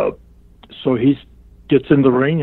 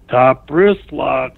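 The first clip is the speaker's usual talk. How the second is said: very slow, louder Top wrist lock; slow call; 5 dB louder, much slower than usual. Retold or imitated. Calling the hold a third time, slow and louder. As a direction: drag out Top wrist lock each word.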